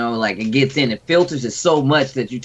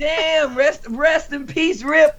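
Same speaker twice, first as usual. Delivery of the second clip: much higher, slow mock lament; mock mourning; 14 semitones higher, much slower than usual. Friend teasing Rip with a hammed-up mock mourning. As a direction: wail the name like a fake eulogy.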